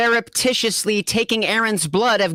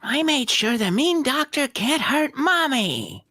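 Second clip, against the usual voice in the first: put-on higher, proud little-kid voice; a small boy pleased with himself; proud little boy telling his dad; child voice; 7 semitones higher than usual. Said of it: "Dargo tells him".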